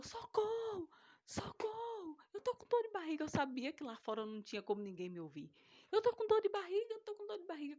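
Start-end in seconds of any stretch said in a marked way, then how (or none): 0.0-3.2 much higher, crying out for help; high tearful cry for help; desperate plea. Retold or imitated. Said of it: "E eu". 5.9-7.8 much higher, crying out for help; high tearful cry for help; desperate plea. Retold or imitated. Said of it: "E eu".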